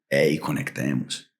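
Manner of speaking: soft, low and slow invitation; mock-romantic low murmur; a hint of a seductive pitch-down; tender connecting voice. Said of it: "entra en un estado como de".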